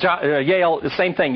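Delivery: ordinary talk, nothing marked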